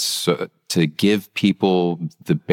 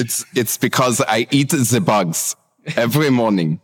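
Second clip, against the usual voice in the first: higher-pitched mock German accent; 6 semitones higher than usual. Co-host joking about his bug breakfast, in a put-on German accent. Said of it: "your German accent was way better than Luke's".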